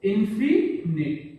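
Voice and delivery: Infinite drawn out slowly; teacher stretching infinite; slow dictation. Shown as a demonstration. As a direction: say infinite slowly so kids copy it. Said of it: "क्या बोला जाता है?".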